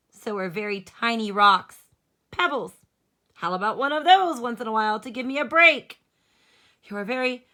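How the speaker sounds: exhausted crayon griping loudly to Duncan; worn-out gray crayon. Acted. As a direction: moan about the huge animals, overworked and fed up. Reads Gray Crayon's letter.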